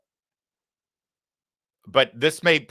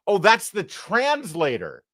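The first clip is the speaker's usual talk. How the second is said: ordinary talk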